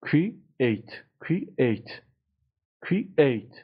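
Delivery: "Create" broken into cre, then ATE, with a gap; teacher sounding out cre... ATE; syllables split apart. Shown as a demonstration. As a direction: separate the two syllables of "Create", stressing the second. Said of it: "استرس رو بخش دومه".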